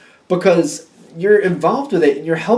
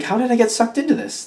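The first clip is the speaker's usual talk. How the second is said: higher-pitched, put-upon question; mock disbelief; 6 semitones higher than usual. Reader voices someone roped into helping, mock disbelief. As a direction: ask it as if mildly put out.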